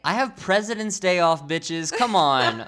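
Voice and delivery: loud, high indignant rant; mock outrage. Real, not acted. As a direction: rant loudly about the day off. Mock outrage about day off.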